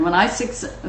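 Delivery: ordinary talk, nothing marked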